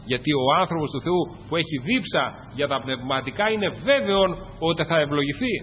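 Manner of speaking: normal talking voice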